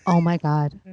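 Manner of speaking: low, hushed gasp of Oh my God; stunned. Retold or imitated. Hearing her own voice in the documentary, stunned and low. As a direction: let it drop out low in shock. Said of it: "I was like".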